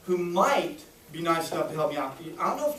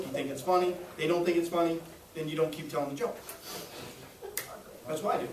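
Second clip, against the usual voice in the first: ordinary delivery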